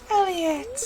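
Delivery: sharp gasp then calling the name; gasping, calling out to Elliot the pet; startled gasp. Real, not acted. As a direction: catch your breath and call out.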